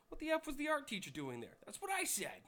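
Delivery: high, loud, indignant punchline; mock outrage. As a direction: blurt it out in acted disbelief. Boy and father outraged in joke.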